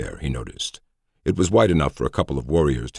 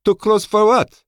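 high, loud commanding officer voice; crisp female officer, firm and high; female colonel voice; 7 dB louder than usual. A narrator's reading. Colonel Schoen voiced by narrator.

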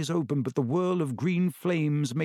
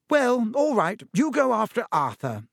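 motherly voice; 7 semitones higher, 3 dB louder than usual. Acted. Mrs Weasley relenting.

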